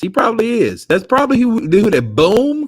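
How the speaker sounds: squeaky high mock voice, slowed at the end. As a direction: pitch it way up to tease. Mocking a fighter's voice.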